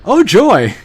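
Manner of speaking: loud, high mock delight; sarcastic cheer. Real, not acted. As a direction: yell it with fake joy. Sarcastic outburst at dark scene.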